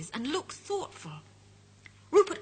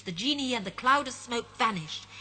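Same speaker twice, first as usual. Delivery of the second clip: ordinary delivery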